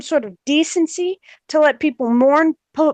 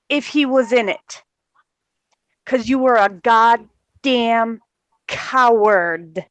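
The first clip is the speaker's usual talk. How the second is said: ordinary talk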